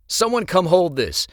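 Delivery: loud, high call for help; urgent call. Acted. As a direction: call out over the crowd.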